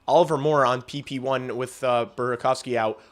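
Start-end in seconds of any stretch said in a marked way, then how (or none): none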